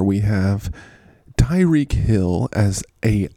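normal talking voice

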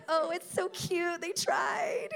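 high, loud cooing over something cute; fond, high baby-talk coo; doting Lord. Pretend. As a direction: coo like a proud parent. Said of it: "He's like".

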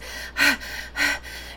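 breathless gasp after running; winded gasp. Acted. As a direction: gasp for air as you arrive. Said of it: "very windedly".

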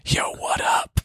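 breathy theatrical whisper; stage whisper. Shown as a demonstration. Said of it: "but a stage whisper".

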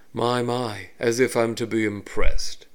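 smug, elegant elf villain; refined villain voice. Acted. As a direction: drawl it with cool disdain.

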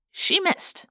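plain reading voice

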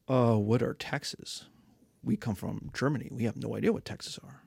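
a barbarian baffled by taxes; low dim barbarian. Acted. Confused barbarian.

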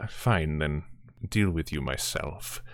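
low, menacing tower voice. Acted. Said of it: "the voice of the tower say".

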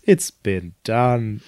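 slow, low dismissive impression. Passing musician to rooftop singers, slow low mock verdict. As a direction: drop it slowly, lightly impersonated. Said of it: "[name] drives by".

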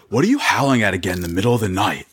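grumpy Chad voice. Acted. Wen Chao snaps at Wang Lingjiao.

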